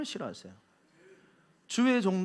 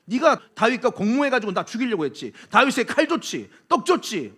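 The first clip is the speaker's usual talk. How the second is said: loud, higher, furious accusing king; enraged accusation; 5 semitones higher, 5 dB louder than usual. Acted. King Saul accusing the priest in a fury. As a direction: spit the charges out in a rage. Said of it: "눈이 뒤집히더니".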